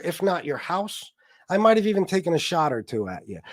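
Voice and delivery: ordinary delivery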